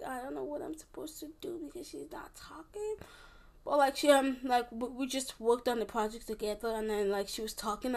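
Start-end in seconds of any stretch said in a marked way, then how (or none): 0.0-2.9 hushed whisper to herself; nervous whisper beside a silent partner. Retold or imitated. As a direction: whisper it so the quiet girl can't hear. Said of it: "I just was like".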